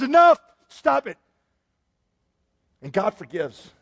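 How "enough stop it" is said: sudden loud, high command; commanding halt. Acted. God calls a halt to the punishment, loud command. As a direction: cry out to stop it at once. Acting God halting the judgment.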